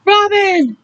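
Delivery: high, loud scream of a name; alarmed screamed call. Acted. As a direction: shriek her name in alarm. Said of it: "Willa screamed".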